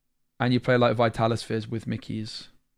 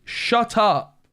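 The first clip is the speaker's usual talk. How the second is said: high, loud furious shout of "Shut up"; angry shout; 10 semitones higher, 4 dB louder than usual. Real, not acted. Streamer shouting at a viewer who mocked the deck, furious. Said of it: "I told to shut up".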